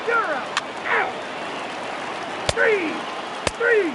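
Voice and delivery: very high, punched umpire call; umpire's shout. Acted. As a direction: shout the call across the field. Umpire calling strikes and outs.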